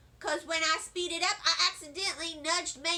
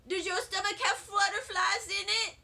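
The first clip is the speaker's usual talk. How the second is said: nervous shout; 5 semitones higher than usual. Acted. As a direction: call it out, jittery with stage fright. Child nervously asks friend backstage.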